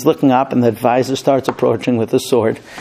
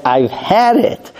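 exasperated father, higher and drawn out; fed up; 7 semitones higher, much slower than usual. Acted. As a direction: at the end of your patience, stretch it out. Fed-up father's final outburst.

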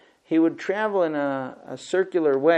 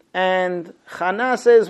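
usual speaking voice

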